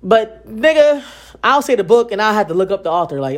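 ordinary delivery

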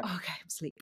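suddenly hushed, imitating someone nodding off; sleepy. Host imitates a girl settling after shaking out wiggles, sleepy. She acts child dropping to sleep.

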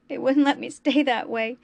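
a tearful outburst against being brought back; sobbing. A narrator's reading. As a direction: choke the words out through tears. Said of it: "he sobbed".

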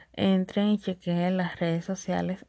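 usual speaking voice

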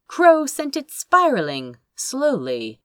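ordinary delivery, nothing marked

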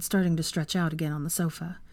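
ordinary delivery, nothing marked

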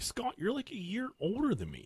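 high, soft voice of disbelief; incredulous kid. Retold or imitated. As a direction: question the plan in a small high voice. Doubting older friend's plan.

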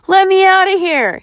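high-pitched, insistent demand; panted demand. A narrator's reading. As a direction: demand release from being trapped. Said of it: "he demanded, panting".

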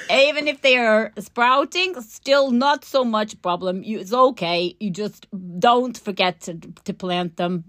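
put-on careful, playful Dutch accent. Dutch grower advising on late tulip planting, Dutch accent. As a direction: imitate a Dutch grower giving advice. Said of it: "shall I do my Dutch accent".

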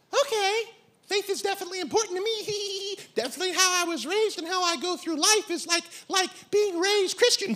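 high, girlish put-on voice; airy imitation of her, quick and high; dating-show woman.

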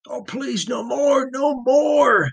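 high, rising desperate plea; begging. Acted. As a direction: beg harder with each phrase. Tortured prisoner begging.

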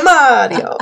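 high, mock Italian accent; Mario. Shown as a demonstration. Co-host finishes the Mario line for laughs, Italian accent. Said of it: "do an Italian accent".

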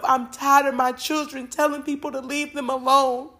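exasperated divine complaint; God at breaking point. Acted. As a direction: speak as a weary parent who has had enough. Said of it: "got to his breaking point".

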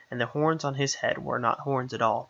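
ordinary delivery

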